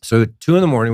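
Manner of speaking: usual speaking voice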